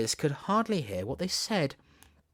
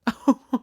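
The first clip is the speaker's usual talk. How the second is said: laugh; 11 semitones higher, 7 dB louder than usual. A narrator's reading. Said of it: "her hand shook so with laughing".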